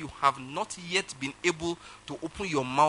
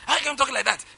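high, indignant retort; mock-offended phone protest; retold temper; 10 semitones higher than usual. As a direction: re-enact an outraged comeback, pitch leaping up. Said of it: "I just lost my temper".

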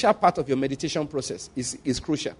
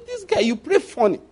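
wife's teasing jab, pitched way up; high-pitched teasing wife quote; mock wife's teasing; 10 semitones higher than usual. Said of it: "I say".

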